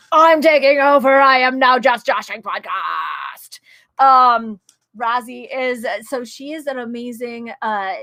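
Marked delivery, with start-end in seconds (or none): 0.1-3.6 loud, playful proclamation; big hammed-up announcer shout, slowing at the end; mock takeover announcement. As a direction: proclaim it like seizing the show. Mock takeover of absent host's show.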